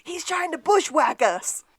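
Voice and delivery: mock frontier panic; hammed-up cowboy alarm. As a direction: shout it like a spooked cowpoke. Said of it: "acting Sean".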